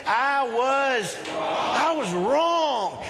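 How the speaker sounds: high, drawn-out emphatic declaration; like you mean it. Shown as a demonstration. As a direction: proclaim it slowly, leading the congregation. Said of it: "let's say it like you mean it".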